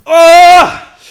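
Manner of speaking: loud, high pained shout; pained yell. Real, not acted. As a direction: cry out from the burn. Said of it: "I need a milk".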